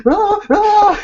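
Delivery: retold shrieks; panicked scream. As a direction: flee the wasps. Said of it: "We're all freaking out".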